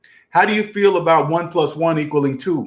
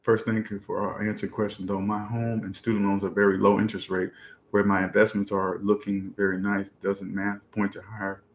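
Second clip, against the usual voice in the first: low, very soft reading to oneself; comment read-out; 8 semitones lower, 9 dB quieter than usual. Real, not acted. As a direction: read it under your breath, low. Reads out viewer's question.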